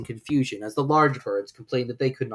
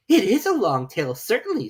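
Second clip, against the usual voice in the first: put-on higher, louder girl's voice; puzzled young girl; Alice voice; 8 semitones higher, 6 dB louder than usual. Alice looking at the tail, higher.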